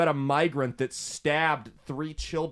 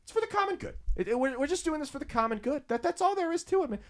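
much higher mock voice sneering "for the common good"; mocking official; 12 semitones higher, faster than usual. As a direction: parody a smug bureaucrat in a falsetto. Mocking government line.

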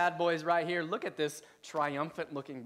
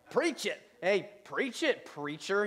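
mock eye-roll jeer; 3 semitones higher, slower than usual. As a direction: imitate a scornful heckle with an eye roll. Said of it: "the eye roll".

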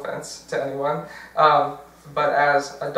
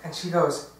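usual speaking voice

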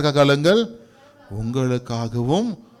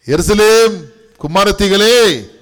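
Jesus calling out; 5 semitones higher, 6 dB louder, slower than usual. Acted. Preacher voices Jesus calling out.

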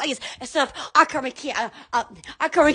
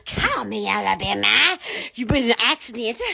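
lightly voiced lower ad read, a touch fast; TV commercial voice; 7 semitones lower than usual. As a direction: do the injury lawyer ad, only slightly put on. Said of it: "the commercials".

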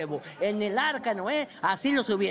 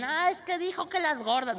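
high put-on whiny voice; mock offended critic; 7 semitones higher than usual. Comic mimicking offended listeners, high and whiny.

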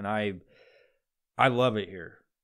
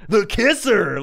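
loud, high station nickname; radio DJ voice; 7 semitones higher, 9 dB louder, slower than usual. Retold or imitated. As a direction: hit it like a hammed-up station ident. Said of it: "this weird fucking voice that they all do".

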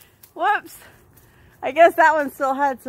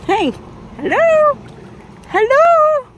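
higher, louder sing-song hello; calling out; 11 semitones higher, 4 dB louder, slower than usual. Real, not acted. Owner calling out loudly to her distracted dog. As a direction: call out to get the dog to look.

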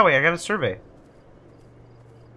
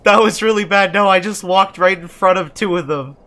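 loud, high-pitched alarm; rattled; 6 semitones higher, 8 dB louder than usual. Real, not acted. Player confessing he walked past two enemies, rattled. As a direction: blurt it out, rattled by a blunder.

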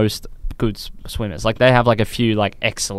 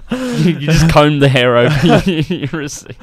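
laughing teasing; 5 semitones higher, 4 dB louder, slower than usual. Real, not acted. Teasing mate about his hair.

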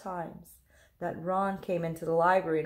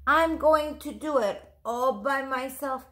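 determined; 5 semitones higher, 3 dB louder than usual. Acted. Ron insists on independence.

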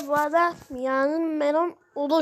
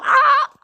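sudden high, louder "ah" of pain; loud pained yell; 8 semitones higher, 11 dB louder than usual. Acted. The hurt one yells from the needle pain. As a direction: cry out sharply as it hurts.

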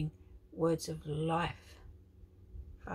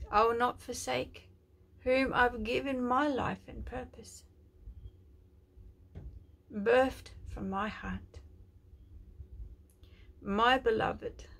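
slow, louder, lifted voice of a loving Father; tender prophetic declaration; 6 semitones higher, 5 dB louder, slower than usual. Acted. Preacher voices God comforting a hurting viewer, slow and tender. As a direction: speak as God comforting his child.

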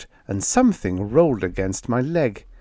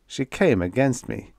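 plain reading voice

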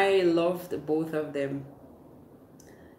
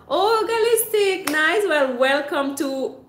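louder, higher, slowed showy welcome; excited welcome; 7 semitones higher, 6 dB louder than usual. Real, not acted. Welcoming a new viewer to Mermaid Nation, excited. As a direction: roll out the greeting like a host.

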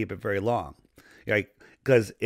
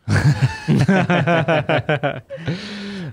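hearty laugh; 6 semitones higher, 5 dB louder than usual. Real, not acted. Laughs after Bill Gates joke.

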